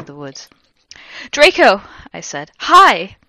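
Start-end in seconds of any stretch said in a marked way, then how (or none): 1.3-1.8 high, loud acted call. Heroine greeting Draco in the woods, high loud call. Heroine calls to arriving boyfriend. 2.6-3.2 high, loud acted hello; eager greeting. Heroine greeting Draco, high loud eager hello.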